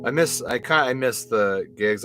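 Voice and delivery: ordinary delivery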